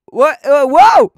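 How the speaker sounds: loud outburst leaping up high; shouted whoa. Pretend. Streamer reins in her chat, high shouted whoa. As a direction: shout them to a halt.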